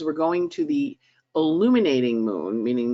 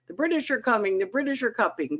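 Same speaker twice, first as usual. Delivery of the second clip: higher mock alarm call; Paul Revere; 7 semitones higher, faster than usual. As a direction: cry it like a rider warning the town. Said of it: "I always feel a little bit like Paul Revere".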